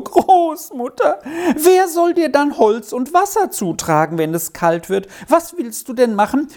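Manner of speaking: high, tearful, sobbing outburst; sobbing plea. A narrator's reading. Girl begging to stay with her grandmother, sobbing high plea. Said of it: "unter heftigem Schluchzen stieß es hervor".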